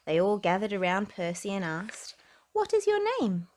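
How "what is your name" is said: higher eager question; friendly animals asking; curious animals. Acted. Animals asking Percy, high curious question.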